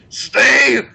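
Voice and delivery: loud barked command; shout. Retold or imitated. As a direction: yell it at a beaten man. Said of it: "the samurai's just like".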